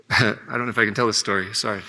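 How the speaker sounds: low, emotional half-laugh; real emotion, trying to hold it together; choked up. Real, not acted. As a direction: apologize while fighting back emotion. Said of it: "I didn't think I'd get emotional".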